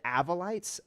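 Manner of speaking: "Avolites" as AV-uh-lites, asked; short-a pronunciation. Shown as a demonstration. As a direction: try "Avolites" with a short AV opening. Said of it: "four different ways that you can".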